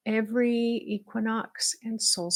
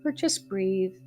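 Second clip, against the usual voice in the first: measured meditation guide with long pauses; guided meditation. Acted. Leading listeners to breathe and feel supported, in a guided meditation voice. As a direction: guide them gently inward, phrase by phrase.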